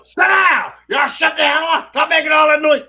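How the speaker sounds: high, loud impression of mom hollering; full-volume mock scolding; yelling mom. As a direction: holler at the kids like a fed-up mother. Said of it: "and my mom".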